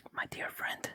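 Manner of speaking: usual speaking voice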